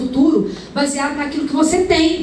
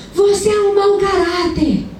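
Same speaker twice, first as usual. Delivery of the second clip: retold cursing yell; 7 semitones higher, slower than usual. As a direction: spit out the curse at the boy. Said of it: "ela ia começar a amaldiçoar o menino".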